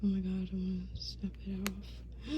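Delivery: hushed, voiceless aside of delight; excited whisper. Real, not acted. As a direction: whisper it, barely containing yourself. Delighted reaction to the skirt.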